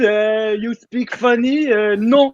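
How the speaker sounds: slow, put-on French accent; mock French voice. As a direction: drawl it like a haughty Frenchman. Mock France speaking funny.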